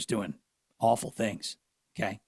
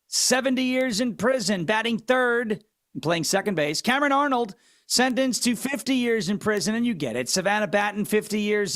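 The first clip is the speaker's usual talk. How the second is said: higher lineup-announcer voice, hammed-up; mock ballpark announcer; 4 semitones higher than usual. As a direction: call the names like a stadium PA announcer. Baseball lineup announcer bit.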